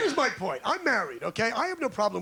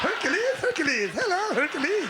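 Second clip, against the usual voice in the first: mock greeting call; 8 semitones higher, 4 dB louder than usual. Calling out to audience member.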